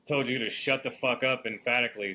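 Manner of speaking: usual speaking voice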